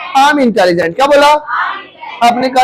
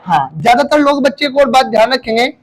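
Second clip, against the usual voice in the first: ordinary talk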